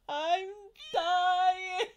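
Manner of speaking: high, loud, hammed-up sung wail; dramatic mock wail. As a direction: play the baby's over-the-top dying cry. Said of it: "really dramatic".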